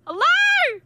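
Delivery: high, loud call into the void. Real, not acted. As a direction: call out, unsure who is there. Calling out to unknown voice.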